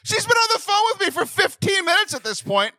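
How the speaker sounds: laughing outrage; exasperated rant. Real, not acted. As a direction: vent the absurdity. Ranting about the mistake.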